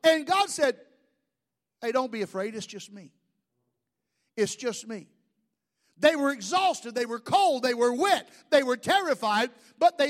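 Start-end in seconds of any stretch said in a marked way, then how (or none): 1.8-5.1 soft, low, casual reassurance; calm them quietly, voice dropped low; easy, hushed comfort in God's role; gentle reassurance. Acted. Voices God reassuring the frightened men.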